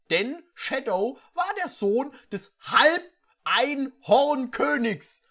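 slow, weighty announcement; slow dramatic reveal. A narrator's reading. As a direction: unveil it like a grand secret.

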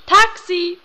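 high, loud taxi hail. Acted. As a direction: yell it so the driver hears. Hailing a taxi, louder.

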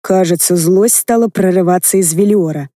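plain reading voice

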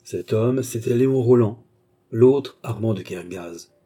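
usual speaking voice

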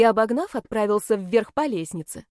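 ordinary delivery

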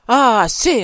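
loud rough bark. Acted. Husband pushing his pleading wife away, loud rough bark. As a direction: cut her off harshly. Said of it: "répéta-t-il rudement".

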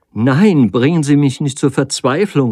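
crying out in despair; cries out. A narrator's reading. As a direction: plead in alarm, pushing him off. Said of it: "rief sie".

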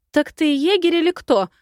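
high, loud accusing question; sharp demand with pitch leaping up; pouncing on him. A narrator's reading. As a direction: round on him indignantly. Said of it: "Вновь накинулась на беловолосого засранца".

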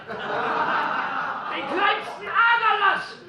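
high, slow, laughing triumph; drawn-out mocking glee; gloating laugh. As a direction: savour the petty revenge.

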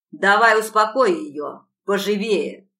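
loud slow barked command; harsh order. A narrator's reading. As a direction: push her roughly.

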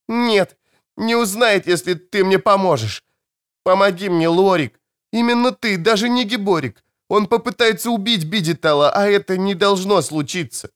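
put-on high, pleading young woman's voice; wounded girl. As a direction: beg him urgently, in pain.

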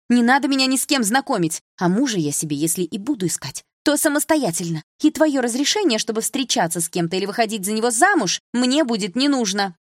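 high, loud indignant protest; heated insistence on independence; defiant anger. A narrator's reading. Defiant daughter quarrels with father.

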